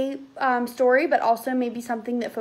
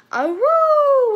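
high, loud, drawn-out howl; wailing wolf howl; 13 semitones higher, 9 dB louder than usual. A narrator's reading. Five baby werewolves answering their mother, wailing howl. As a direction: wail it like wolf pups. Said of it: "wailed the five".

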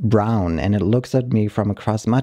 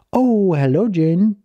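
tall skinny's greeting; 9 semitones higher than usual. Retold or imitated. Boyfriend greeting the dog in a high cheerful voice. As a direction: greet the dog in a sing-song lift. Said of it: "Tall skinny makes noise like".

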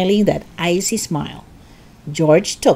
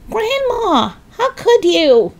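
put-on high, horrified child; disgusted boy voice; 3 dB louder, slower than usual. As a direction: squeal it out in disgust. George disgusted by grandma.

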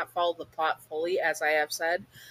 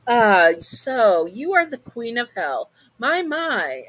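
louder, higher, lightly voiced showman demon; Alistair's voice; 6 semitones higher, 7 dB louder, slower than usual. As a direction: savor the title like a theatrical host. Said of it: "Alistair says".

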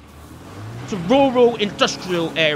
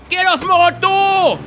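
much higher, louder, slowly stretched bellow; furious yell; 10 semitones higher, 5 dB louder, slower than usual. Real, not acted. Gamer bellowing at a zombie on his car door, slow furious yell. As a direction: bellow it long at the pest.

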